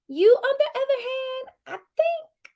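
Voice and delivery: high mocking sing-song; high teasing voice. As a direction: tease her with a high lilt. Teasing the contestant.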